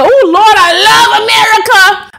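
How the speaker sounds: high, loud, wailing declaration of love; overjoyed mock outburst; hammed-up patriotic fervor. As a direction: cry it out like praising in church.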